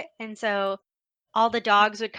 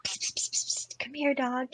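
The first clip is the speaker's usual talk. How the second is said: whisper; 3 semitones higher, 5 dB quieter than usual. Retold or imitated. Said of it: "try to be like".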